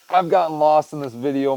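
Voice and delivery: ordinary talk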